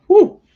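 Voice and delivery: a high, loud whew of admiration; impressed whew. Real, not acted. Reviewer reacting to a lyric, high loud whew. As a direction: blow out a hyped whew after the verse. Hyped by a favourite rap line.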